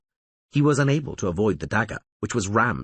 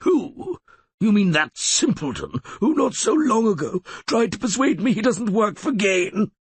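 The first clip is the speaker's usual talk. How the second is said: sneering; 12 semitones higher, 3 dB louder than usual. A narrator's reading. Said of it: "sneered Codringer".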